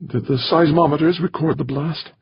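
words tumbling out high; an anxious question popping out; blurted. A narrator's reading. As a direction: blurt it before you can stop. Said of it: "Rosenberg blurted".

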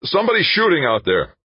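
high, loud warning cry; alarmed shout. Acted. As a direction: yell it in panic. Agent warning of shooting.

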